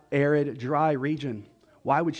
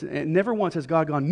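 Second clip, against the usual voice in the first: normal talking voice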